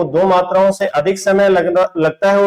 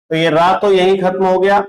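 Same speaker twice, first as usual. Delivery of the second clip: usual speaking voice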